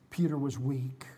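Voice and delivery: ordinary delivery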